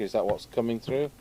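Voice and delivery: ordinary talk, nothing marked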